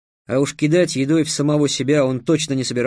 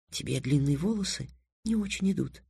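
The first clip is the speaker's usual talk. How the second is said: hushed, much softer voice; whispered; 10 dB quieter than usual. A narrator's reading. Girl whispers to Трикс about his haircut, softly. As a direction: keep it quiet, a private remark. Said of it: "шепнула Триксу на ухо Тиана".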